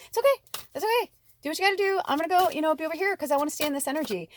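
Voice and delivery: very high, sing-song kind goodbye; breezy cheerful brush-off. Shown as a demonstration. Leaving a gloomy friend, breezy and cheerful. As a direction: lightly wave them off, sweetly. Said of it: "and be like".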